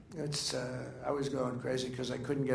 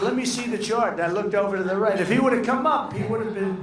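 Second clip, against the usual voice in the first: excited retelling; 7 semitones higher, 7 dB louder than usual. Retold or imitated. Retelling the rally moment excitedly.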